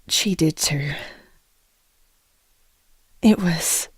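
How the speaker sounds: choked, breathy whisper through tears; tearful whisper. Acted. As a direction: barely get the words out, holding back sobs. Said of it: "I cried a lot".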